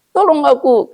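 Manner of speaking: high, begging camel voice; pleading camel. Acted. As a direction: beg for help like a desperate animal. (unta memohon dengan suara tinggi)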